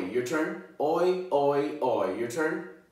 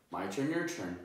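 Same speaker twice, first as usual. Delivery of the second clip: ordinary talk, nothing marked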